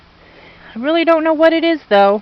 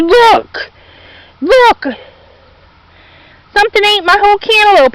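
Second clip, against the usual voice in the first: upset ranting; 7 semitones higher than usual. Real, not acted. Gardener showing viewers her eaten melon, upset and ranting. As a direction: show the viewer the damage, all worked up. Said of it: "Sorry for that little rant".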